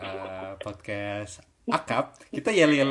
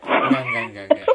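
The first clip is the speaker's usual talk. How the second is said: laughing; 8 dB louder than usual. Real, not acted. Laughing denial.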